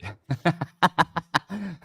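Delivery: a burst of high-pitched laughter. Real, not acted. Laughs at own Canadian joke.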